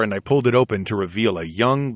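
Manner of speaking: plain reading voice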